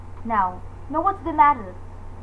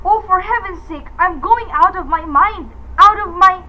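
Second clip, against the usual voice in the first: frantic; 4 semitones higher, 7 dB louder than usual. Acted. Phone keeps ringing, she panics.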